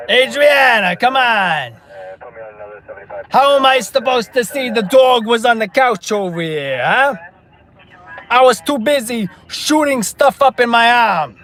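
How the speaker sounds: loud, high-pitched mock New York Italian protest; New York Italian accent. Streamer playing Christopher answering Adriana, loud New York Italian accent. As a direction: argue back loudly, hammed-up New York Italian accent. Acts Christopher's reply from The Sopranos.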